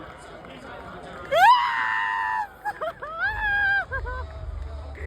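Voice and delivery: long, very high shrieking laugh; scream-laugh with joy; wild fangirl squeal-laugh; ecstatic screaming laugh. Real, not acted. Ecstatic about Oscar winning.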